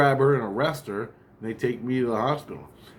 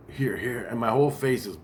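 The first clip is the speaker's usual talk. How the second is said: normal talking voice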